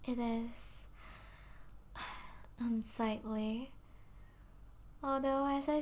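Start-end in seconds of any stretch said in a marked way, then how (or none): 0.9-2.5 quiet, breathed-out sigh; soft sigh. Acted. Vampire troubled by affection for humans, soft sigh. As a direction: let out a low-volume sigh.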